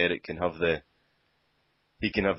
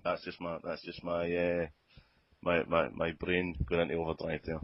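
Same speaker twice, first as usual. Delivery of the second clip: ordinary talk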